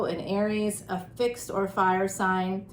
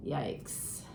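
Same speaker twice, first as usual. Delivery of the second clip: whispered; 6 semitones lower, 11 dB quieter than usual. Real, not acted. Worried aside about covid.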